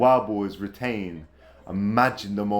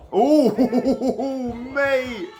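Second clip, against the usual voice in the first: laughing; 14 semitones higher, 5 dB louder than usual. Real, not acted. Commentator surprised mid sentence.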